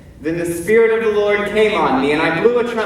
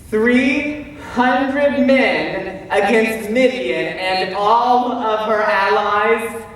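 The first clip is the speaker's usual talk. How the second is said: slow, raised-pitch disbelief; incredulous; 4 semitones higher, much slower than usual. Acted. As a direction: spell out the impossible odds slowly. Gideon stunned by tiny army.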